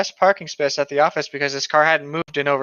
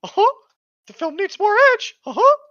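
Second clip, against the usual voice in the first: much higher, squeaky, chirpy mock; 5 dB louder than usual. Mocking Disney executives, high squeaky parody.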